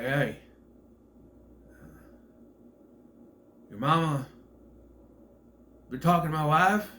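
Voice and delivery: imitated Southern confrontation; imitated southern accent. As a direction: put on a Southern movie tough-guy accent. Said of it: "everybody's southern accent is over the top".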